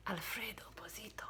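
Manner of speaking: breathy hushed whisper, no voice. A narrator's reading. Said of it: "whispered the crowd".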